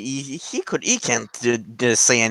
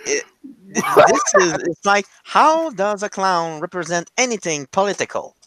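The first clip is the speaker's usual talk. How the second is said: incredulous; 6 semitones higher than usual. Real, not acted. Disbelief at a clown as symbol.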